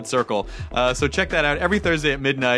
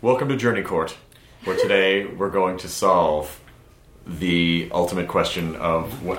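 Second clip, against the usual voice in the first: mock court host; 7 semitones lower than usual. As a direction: open a mock trial with grave ceremony. Plays judge of mock court.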